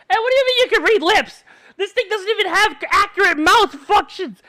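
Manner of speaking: high, loud indignant protest; indignant yell. Real, not acted. Streamer yelling at chat about lip reading, loud protest. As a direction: shout it in disbelief.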